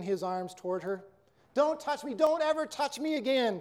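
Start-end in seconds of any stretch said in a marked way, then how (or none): 1.5-3.6 higher, understated tearful refusal; a mild tearful push-away; sobbing. A narrator's reading. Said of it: "Kimberly sobbed".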